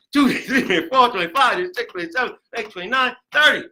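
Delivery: high, hurried count over a beat; driving rhythmic count to a punched finish; counting out loud. Shown as a demonstration. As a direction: keep counting loudly through the effort. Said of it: "You gotta count out loud".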